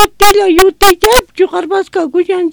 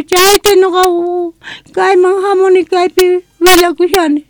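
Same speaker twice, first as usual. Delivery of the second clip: ordinary talk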